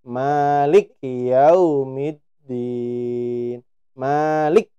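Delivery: "Malik" slow with lam joined to kaf; wrong joined lik. Shown as a demonstration. Teacher repeats the wrong reading for students. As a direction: run "lik" together, wrongly. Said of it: "lik sudah salah".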